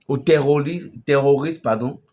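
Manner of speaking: ordinary delivery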